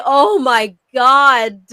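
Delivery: long, drawn-out mock groan; acted-out heartfelt dismay, very slow; pained exasperation. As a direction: stretch it out slowly in sympathy. Acts out pained reaction to story.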